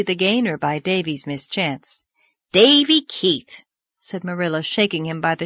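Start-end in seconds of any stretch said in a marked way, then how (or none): 2.5-3.6 loud, drawn-out name, pitched high; stern scolding. Acted. Marilla scolding Davy for climbing the table, loud stern call. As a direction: call the boy to account sharply.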